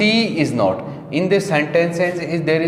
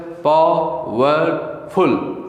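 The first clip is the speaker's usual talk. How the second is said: Powerful said slowly, pa-war-ful; syllables drawn out; much slower than usual. Shown as a demonstration. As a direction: stretch powerful to show its three syllables. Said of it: "देखो कितने syllable है".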